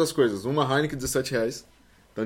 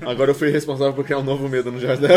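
usual speaking voice

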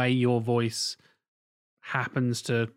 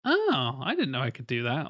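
mock discovery. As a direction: act out pleasant surprise at yourself. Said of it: "how many actors have that moment of, like".

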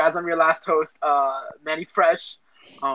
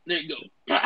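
normal talking voice

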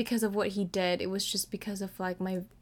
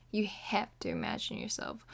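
softer intense whisper; emphatic whisper; 6 dB quieter than usual. Real, not acted. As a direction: lean in and whisper it. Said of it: "I emphasize this".

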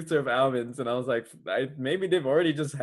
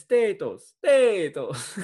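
drawn-out bad Irish accent; attempted Irish accent; 6 semitones higher, slower than usual. Shown as a demonstration. Contestant showing the host it differs from Italian, bad Irish accent. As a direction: insist on it in a hammy Irish accent. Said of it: "That's different".

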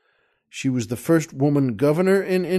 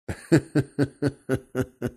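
steady trailing chuckle; real laugh; 3 dB quieter than usual. Real, not acted. Joke teller amused by the warden's response, laughing. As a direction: chuckle away at your own gag.

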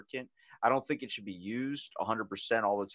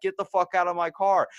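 higher, louder re-enacted order; retold yelling; 8 semitones higher, 5 dB louder than usual. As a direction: act out yelling at an intruder. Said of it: "Started yelling at her".

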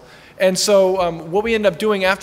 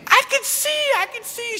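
imitating an ecstatic cry, much higher; mock joyful scream. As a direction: squeal it out in joy, very high. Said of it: "she starts screaming".

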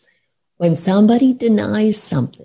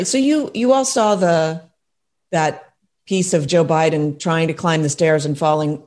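ordinary talk, nothing marked

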